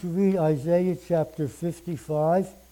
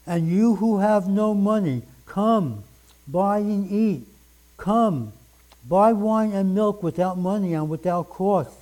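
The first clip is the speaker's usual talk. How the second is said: louder, raised proclaiming of the verse; fervent scripture reading; 3 semitones higher, 4 dB louder than usual. Acted. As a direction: call out the invitation like a preacher at full force. Preacher reads scripture aloud.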